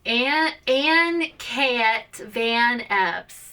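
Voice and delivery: "Ann Cat Van Epps" with hard, drawn-out A's, loud and slow; overpronounced A's. Retold or imitated. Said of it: "he really overpronounced it".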